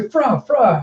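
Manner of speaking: high, yelped creature noise; pet bark. Acted. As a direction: imitate a small robot dog barking. Imitating the robot pet's bark.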